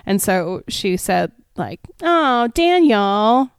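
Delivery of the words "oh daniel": slow, higher put-on voice of the sister; mock sister voice. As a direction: draw it out as a hammed-up complaint. Voicing sister in the retold joke.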